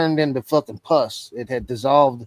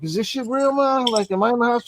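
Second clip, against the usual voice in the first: pitched up and shaken, asking his mom if this is real; panicked disbelief; 8 semitones higher, faster than usual. Retold or imitated. To his mom on the phone from the hospital, high and disbelieving.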